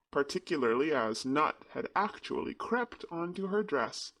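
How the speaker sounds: usual speaking voice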